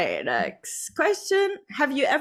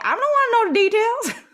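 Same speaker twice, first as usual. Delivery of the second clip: high, loud mock-horrified protest; squeamish parent refusal; 13 semitones higher, 8 dB louder than usual. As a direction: protest in a raised squeal, half laughing.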